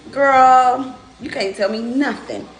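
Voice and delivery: loud sassy brag; sassy bragging. Real, not acted. Bragging about her pickles.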